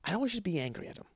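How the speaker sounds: soft, private note from the director; hushed director aside. Retold or imitated. Director giving a quiet note off to the side. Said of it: "director pulls me aside".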